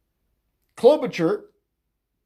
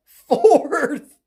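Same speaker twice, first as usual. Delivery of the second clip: higher, louder, laughing disbelief; laughing, drawn-out exclamation; incredulous repeat; 7 semitones higher, 6 dB louder than usual. Real, not acted. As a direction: blurt it out through a laugh, amazed.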